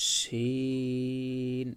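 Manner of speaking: শিন said very slowly and drawn out; Arabic letter demo. Shown as a demonstration. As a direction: hold শিন long and clear. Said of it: "আবার বলি". (ধীরে টেনে শিন উচ্চারণ)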